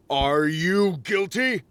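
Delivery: high slow accusation; slow accusing. A narrator's reading. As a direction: accuse her slowly. Accusing Rachel.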